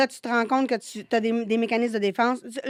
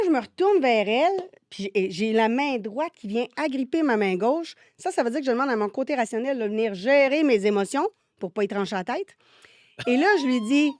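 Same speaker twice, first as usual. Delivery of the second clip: ordinary talk, nothing marked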